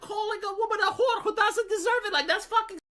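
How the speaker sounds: high, laughing disbelief; giggling high-pitched disbelief. Real, not acted. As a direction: laugh your way through the line. Laughing while saying it.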